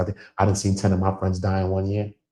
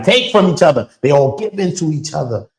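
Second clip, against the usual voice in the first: impassioned preaching; 8 semitones higher, 11 dB louder than usual. Real, not acted. Preaching about giving.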